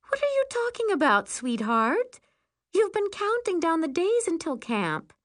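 high, disbelieving mom voice; high-pitched motherly protest; surprised, coaxing mother. Acted. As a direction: talk a balking child round. Mother reassures balking child.

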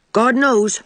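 "God knows" tossed off lightly and quickly; breezy, careless little "God knows"; airy. A narrator's reading. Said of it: "said Davy airily".

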